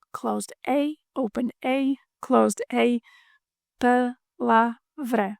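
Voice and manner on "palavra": palavra stretched very slowly, each syllable clear; slow practice. Shown as a demonstration. Teacher slows palavra right down for learners. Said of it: "practice repetition".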